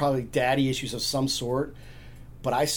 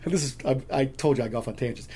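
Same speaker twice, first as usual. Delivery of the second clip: usual speaking voice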